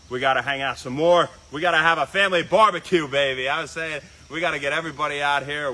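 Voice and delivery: loud, pumped-up rallying call; excited loud invitation. Real, not acted. Host urging Frank to have a family barbecue, excited and loud. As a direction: get loud and hyped, pushing for a get-together.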